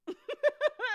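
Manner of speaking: a high burst of laughter; squealing giggle. Real, not acted. Laugh after exasperation.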